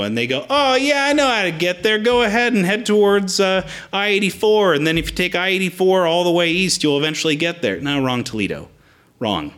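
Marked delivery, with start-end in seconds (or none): 0.5-7.8 know-it-all. Retold or imitated. Mimicking a know-it-all giving directions.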